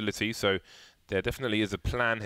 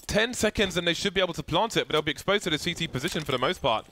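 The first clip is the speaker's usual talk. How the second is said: high, urgent play-by-play; excited commentary; 8 semitones higher, 3 dB louder, faster than usual. Real, not acted. Caster calling the last seconds before a plant, excited. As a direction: race through it high, easing down gradually.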